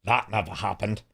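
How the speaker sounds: low-key imitation of a snide aside; quiet jab leaned toward a listener; mocking aside. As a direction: say it softly, mocking the storyteller. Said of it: "as if to mock Sven".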